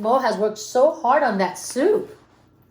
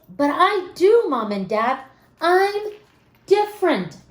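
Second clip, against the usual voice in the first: put-on higher, earnest kid insisting; pleading child voice; 6 semitones higher than usual. Mo telling his parents he is different, earnest. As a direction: stand up for yourself like a small boy. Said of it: "said Mo".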